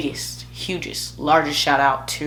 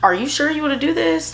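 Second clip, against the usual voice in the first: mom crying; 11 semitones higher, faster than usual. Retold or imitated. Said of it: "she just started crying".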